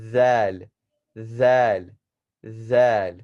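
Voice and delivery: zal with a lisped Z, tongue between the teeth, loud. Shown as a demonstration. Teacher drilling zal with a lisped Z. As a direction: lisp the Z in zal. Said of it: "we're saying the Z sound".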